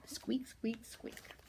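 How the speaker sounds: soft, hushed little squeaks; tiny whispered mouse squeaks. Shown as a demonstration. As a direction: breathe the squeaks out quietly. Quiet echo of squeak.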